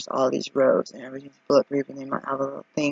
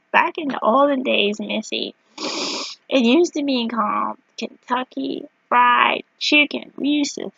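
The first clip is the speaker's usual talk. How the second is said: put-on old-timer telling kids; 10 semitones higher, slower than usual. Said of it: "I told my kids".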